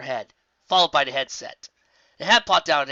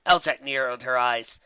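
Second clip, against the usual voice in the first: usual speaking voice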